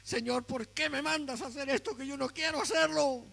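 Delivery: a sulking prophet kicking stones; Hoseas complaining. Acted. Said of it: "quiero ver a Oseas reclamando". (Oseas reclama a Dios con queja)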